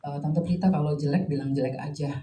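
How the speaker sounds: soft, hushed retold whisper; imitating a whisper. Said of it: "bisikin, terus bilang". (menirukan bisikan pelan)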